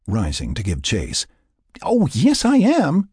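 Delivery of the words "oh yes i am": very high, loud, defiant; shrilly defiant comeback; defiant insistence. A narrator's reading. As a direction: insist right back at him. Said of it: "she insisted".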